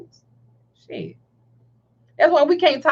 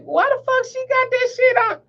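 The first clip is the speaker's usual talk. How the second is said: much higher, mock-incredulous question; judgmental disbelief; 11 semitones higher than usual. Shopper judging a child on a leash, incredulous. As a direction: retell the outrage way up high. Repeats her judging reaction.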